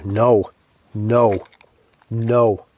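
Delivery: loud sharp command to dogs; loud firm no. Real, not acted.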